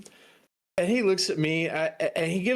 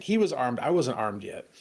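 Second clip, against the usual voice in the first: ordinary talk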